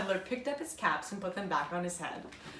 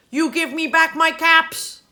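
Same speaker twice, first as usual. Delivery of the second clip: much louder, higher demand; demanding peddler; 7 semitones higher, 14 dB louder than usual. Acted. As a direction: order them to give the caps back. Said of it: "shaking a finger at them".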